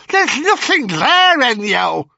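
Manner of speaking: put-on high, loud old crone retort; high hag voice. Hag sister dismissing Ennio, high crone voice. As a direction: snap back like an old witch.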